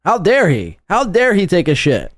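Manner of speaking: ordinary talk, nothing marked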